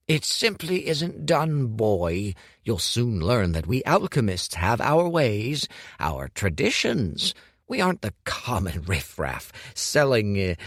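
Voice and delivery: Hu lectures Dorian on alchemist ways, haughty; haughty old master, lecturing. Acted. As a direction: lecture the boy on tradition. Voicing Hu lecturing.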